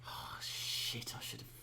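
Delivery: whispered self-reproach; hushed curse, meant only for yourself; muttering under breath. Acted. Character scolding self over a missed chance, muttering under breath. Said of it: "Tetsu mutters under his breath".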